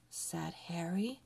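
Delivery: plain reading voice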